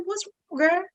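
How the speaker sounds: very soft, high coaxing; attempted empathy. Friend asks crying neighbour again, trying to be empathetic. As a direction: ask gently in a small high voice. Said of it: "but I'm trying to be".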